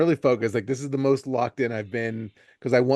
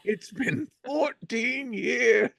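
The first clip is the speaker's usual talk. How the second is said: put-on slow, higher wistful old man; 8 semitones higher than usual. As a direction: drag it out like a nostalgic old-timer. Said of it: "an old man voice".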